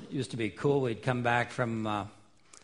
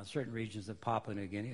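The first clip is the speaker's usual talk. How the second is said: ordinary talk, nothing marked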